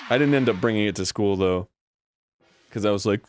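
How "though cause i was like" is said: ordinary delivery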